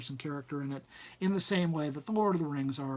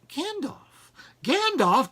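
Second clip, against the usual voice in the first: excited recognition; 12 semitones higher, 8 dB louder, slower than usual. Acted. Bilbo recognises Gandalf.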